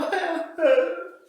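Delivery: high, loud fake wail; mock wailing. Shown as a demonstration. Preacher imitates hired mourners arriving at a wake, mock wailing. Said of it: "ellos llegaban con grito abierto".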